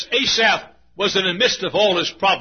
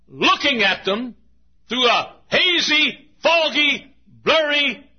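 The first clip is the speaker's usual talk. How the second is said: fervent preaching; 8 semitones higher than usual. Acted. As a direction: preach it out with full force. Preaching at full force.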